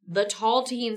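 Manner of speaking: normal talking voice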